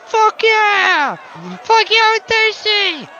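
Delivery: very high, loud crowd yell; screaming crowd. Acted. Protesters cheering back at the orator, very high and loud. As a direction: cheer like a riled-up mob. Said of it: "are all screaming".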